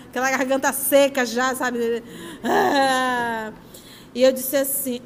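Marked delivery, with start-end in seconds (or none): 2.4-3.5 imitated crying wail. Child crying on the plane.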